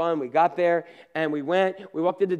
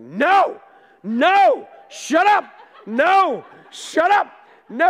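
high, loud frightened no; a scared little girl shouting down the guide; imitated child's panicked shout, much higher and louder; 10 semitones higher, 7 dB louder, much slower than usual.

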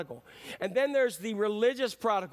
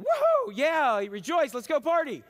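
mock celebration; 6 semitones higher, 5 dB louder, slower than usual. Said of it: "the older brother said".

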